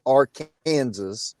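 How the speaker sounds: Arkansas said as ar-KAN-zus; Kansas-style pronunciation. Shown as a demonstration. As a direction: say Arkansas the Kansas way, rhyming its end with Kansas. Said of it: "if you live in the state of Kansas, you pronounce it".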